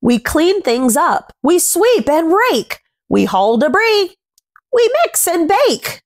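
gentle rhyming picture-book read, words savoured; reading to children. A narrator's reading. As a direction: read the rhyme aloud to little ones. Reads rhyming picture book aloud.

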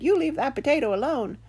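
imitating a bossy little dog; quoted dog. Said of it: "my dog stopped chewing on his bone and said".

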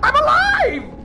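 very high, loud cry of survival; elated shriek. Real, not acted. Player surviving against odds, elated shriek. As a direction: shriek it in amazed relief.

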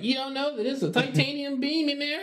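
high sneering know-it-all voice; mocking know-it-all. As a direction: mimic a smug fan correcting you. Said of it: "People like".

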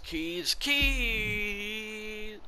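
high, drawn-out call for lost things. Real, not acted. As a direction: let it soar up and stretch the last word. Calling out for lost keys.

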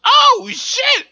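high startled yelp; sudden loud surprise; shocked yelp. Real, not acted. Sudden shock while gaming.

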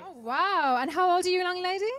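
loud, high-pitched wow of admiration; amazed. Real, not acted.

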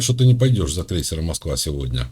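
normal talking voice

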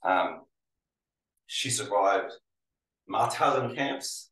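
normal talking voice

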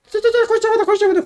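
squeaky high-pitched urging; frantic squeal. Real, not acted. Gamer rushing to finish the roof, squeaky high yelling.

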